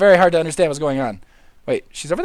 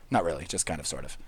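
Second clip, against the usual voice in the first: much lower and softer mumble; muttered aside; quiet aside; 14 semitones lower, 16 dB quieter, much faster than usual. Real, not acted. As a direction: tuck it away under your breath. Drops to tiny aside.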